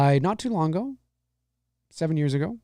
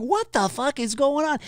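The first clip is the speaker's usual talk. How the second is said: put-on baffled kid; 9 semitones higher than usual. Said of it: "I'm just like".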